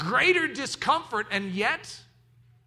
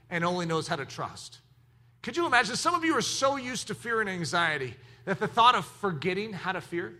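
usual speaking voice

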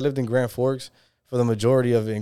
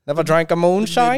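put-on North Dakota accent; 5 semitones higher, 5 dB louder than usual. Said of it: "North Dakota accent".

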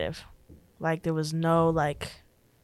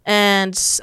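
normal talking voice

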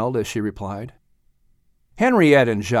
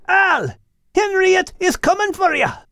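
prank yell. Acted. Max yelling into Al's ear to wake him. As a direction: shout it right in his ear to startle him.

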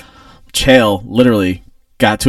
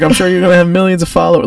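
mocking taunt; 9 semitones higher, 3 dB louder, faster than usual. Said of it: "He's like".

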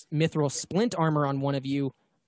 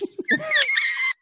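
high shrieking laugh; shrill screeching laugh; 3 dB louder than usual. Real, not acted. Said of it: "Sorry if you hear screeching".

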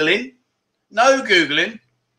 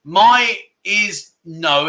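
"my is no" drawn out word by word; very slow "my is no"; much slower than usual. Shown as a demonstration. Teacher corrects himself on "my is no", spelling it out slowly. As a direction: stretch out "my is no" slowly. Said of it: "Well, actually".

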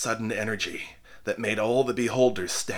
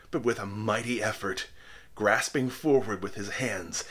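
ordinary delivery, nothing marked

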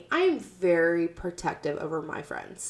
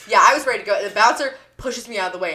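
ordinary talk

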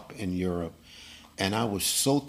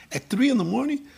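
high, incredulous question; shocked at the hour; wife's disbelief; faster than usual. Retold or imitated. Wife shocked he must leave at three in the morning. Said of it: "She goes".

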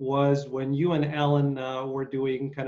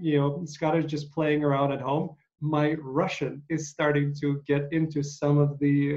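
ordinary talk, nothing marked

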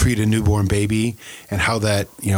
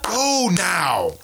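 impatient angel; 6 semitones higher than usual. Retold or imitated. Angel voiced, clapping.

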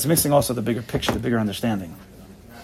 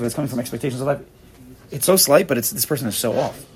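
ordinary talk, nothing marked

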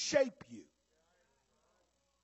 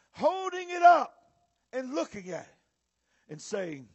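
normal talking voice

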